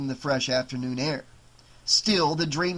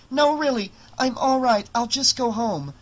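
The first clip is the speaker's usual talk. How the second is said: high, louder, flustered insistence; insistent protest; 8 semitones higher, 4 dB louder than usual. A narrator's reading. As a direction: insist you're fine, voice pitched right up. Girl hastily refusing teacher's help.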